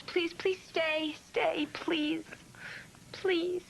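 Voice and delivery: pleading. Acted. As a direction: beg him sweetly to stay. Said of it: "I'm a sucker for a good please".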